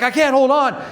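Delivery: high, panicky protest; freaking out. Retold or imitated. Business partner stuck in traffic, imitated panicking. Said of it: "he's freaking out".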